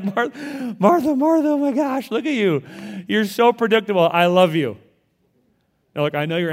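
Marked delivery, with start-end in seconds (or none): 0.0-4.8 very high, loud, amused Jesus voice; laughing Jesus. Retold or imitated. Jesus lovingly teasing predictable Martha, high and laughing. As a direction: tease her with fond laughter. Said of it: "he's like".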